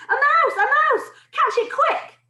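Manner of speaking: high, urgent shouts; shouting. A narrator's reading. Cooks setting off after the falling mouse, shouting. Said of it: "they were shouting".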